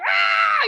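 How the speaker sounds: high loud battle cry; a sudden furious shout; fighting yell. Retold or imitated. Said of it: "I'm just yelling at this guy".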